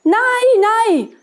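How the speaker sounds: little goats' cry of refusal; children calling out. A narrator's reading. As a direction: call it out like alarmed kids. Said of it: "haben die Kinder gerufen".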